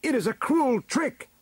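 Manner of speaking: very high, loud protest; high-pitched heroic outburst; crying out. A narrator's reading. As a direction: cry foul before the court. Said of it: "cried He-Man".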